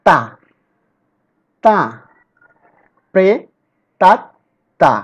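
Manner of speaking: Pray তাত তা each set apart with long pauses; teacher splitting প্রেতাত্মা into syllables. Shown as a demonstration. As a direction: sound out প্রেতাত্মা one syllable at a time. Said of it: "তৃতীয় syllable হচ্ছে".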